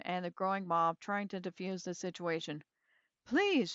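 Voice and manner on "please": high, pleading please. A narrator's reading. Hotel owner calms the mob, pleading high. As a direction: Foyner begs the angry crowd, high and pleading. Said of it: "she pleaded".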